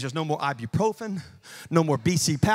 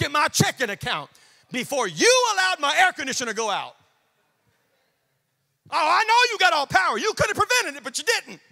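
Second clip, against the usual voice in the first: acted exasperated protest, pitched up; hammed-up aggrieved questioning, climbing higher then dropping off; indignant complaint; 9 semitones higher than usual. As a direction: complain to God in mock outrage. Acts out complaint to God.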